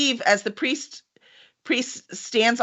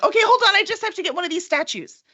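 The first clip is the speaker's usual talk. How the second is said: put-on eager girl; 9 semitones higher than usual. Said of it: "Vanashan's like".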